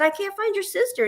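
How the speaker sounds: retold panic, pitched high; panicked. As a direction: tell the boy in a high, frightened rush. Mother panicking to her son.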